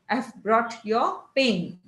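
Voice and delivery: slow Marathi English, pen drawn long; elongated Indian accent. Shown as a demonstration. Teacher demonstrating a Marathi English accent to students. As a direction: stretch every vowel the Marathi way. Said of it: "So it is elongated accent".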